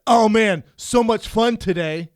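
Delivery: loud, slow mock cheery post; mock cheesy post. Co-host mocking Brian's lazy posts, loud mock cheer. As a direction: read his cheesy post back hammed up. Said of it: "He'll put".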